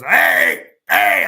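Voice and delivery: loud mock yell across a parking lot; yelling hey. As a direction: bark it out to scare them off. Yelling at gang members.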